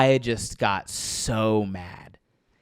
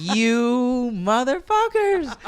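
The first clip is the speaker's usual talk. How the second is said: high, slow, seething insult; drawn-out curse; 12 semitones higher, much slower than usual. Retold or imitated. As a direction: stretch out the betrayal. Cursing his resting unit.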